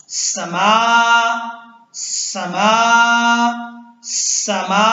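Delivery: "समा" with short "स", long "मा"; stretched meem. Shown as a demonstration. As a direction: draw out the last vowel of "समा". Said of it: "मीम को खींचना है".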